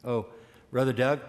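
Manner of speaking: usual speaking voice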